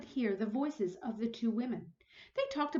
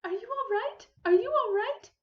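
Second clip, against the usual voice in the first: high, anxious repeated question; worried cry; 12 semitones higher, 7 dB louder than usual. Acted. Missus Prim rushing to the fallen guest, high and worried. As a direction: fuss over the fallen women. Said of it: "Missus Prim cried out".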